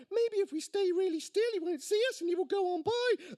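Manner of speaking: put-on high-pitched child voice; young son. A narrator's reading. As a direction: reason it out like a scared small boy. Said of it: "the son said".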